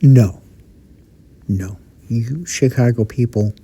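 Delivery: usual speaking voice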